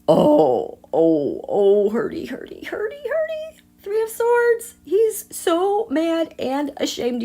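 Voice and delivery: squeaky high, hammed-up ouchy voice, slowing down at the end; mock hurt whimper. Reader mock-wincing at the Three of Swords for viewers, squeaky and high. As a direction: play at being stabbed, cutesy and high.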